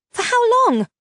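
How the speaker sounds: high, loud angry snap; sharp retort; snapped. A narrator's reading. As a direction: bite the question off. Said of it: "I snapped".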